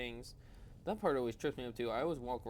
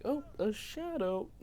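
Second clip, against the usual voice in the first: mock dramatic; 10 semitones higher, slower than usual. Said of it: "that I'm like".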